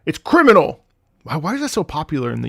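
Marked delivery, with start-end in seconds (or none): 0.1-0.8 louder, higher punch on "criminal"; emphatic quote. Retold or imitated. Streamer imitating YouTube comments, emphatic exclamation. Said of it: "what do they say on my YouTube".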